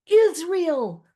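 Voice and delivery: high, loud commanding shout; booming call. A narrator's reading. Moses calling to his terrified people, booming. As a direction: boom the name out over a crowd. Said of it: "Moses' voice boomed".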